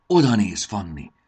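loud first burst, then easing off; a touch of wonder, calling her to look; amazed. A narrator's reading. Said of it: "ámuldozott Marci". (enyhén ámuldozó felkiáltás)

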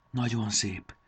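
plain reading voice

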